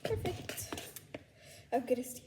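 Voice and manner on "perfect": whispering. Real, not acted. Pleased aside.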